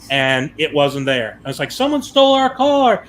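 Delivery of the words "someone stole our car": high, alarmed cry that the car is gone; husband panicking in the rain, high and alarmed; panicked. Retold or imitated. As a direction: blurt it out in sudden panic.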